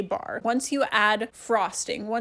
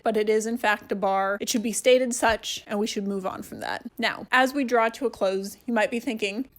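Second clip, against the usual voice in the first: ordinary talk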